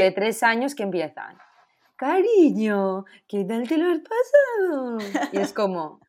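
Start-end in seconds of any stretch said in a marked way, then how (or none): 2.0-5.0 put-on higher, slow sing-song voice for a small child; mock baby talk. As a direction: talk down sweetly to a three-year-old, overdone. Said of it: "si no haces esa entonación". (voz infantil exagerada para un niño pequeño)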